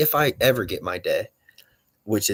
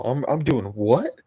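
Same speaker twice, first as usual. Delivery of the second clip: playing dumb; 4 semitones higher than usual. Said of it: "they tell me this every day".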